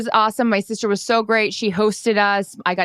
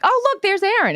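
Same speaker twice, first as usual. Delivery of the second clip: thrilled, much higher squeal; spotting a friend on TV with glee; excited high cry, fan spotting Aaron; 12 semitones higher than usual. Retold or imitated. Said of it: "I'm like".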